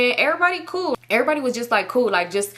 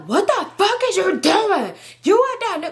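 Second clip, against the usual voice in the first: acted angry boyfriend; 11 semitones higher, 4 dB louder than usual. Boyfriend confronting her on the phone, mock outrage. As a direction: mimic him chewing you out, pitched way up.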